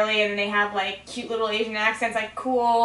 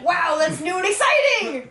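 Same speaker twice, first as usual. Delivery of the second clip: high, drawn-out mock excitement; mock amazement; 6 semitones higher than usual. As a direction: gush slowly with exaggerated wonder. Mock gushing over unfamiliar accents.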